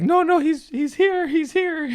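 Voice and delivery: very high, insistent mimicked voice; Dylan Sullivan. Comic imitates host reassuring the crowd, high-pitched. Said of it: "he's got a really high-pitched voice".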